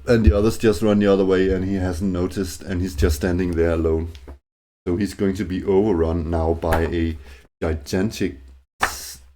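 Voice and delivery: ordinary talk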